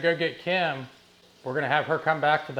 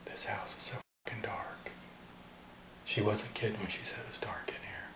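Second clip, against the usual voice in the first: hushed whisper in the dark; 5 semitones lower, 13 dB quieter than usual. Real, not acted. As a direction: whisper it, afraid to be heard. Whispering in a dark haunted house.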